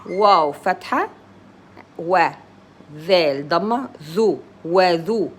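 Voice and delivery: normal talking voice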